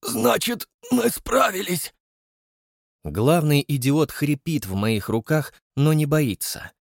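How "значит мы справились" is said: high, ecstatic cry of triumph; ecstatic exclaim. Acted. (экстатический возглас)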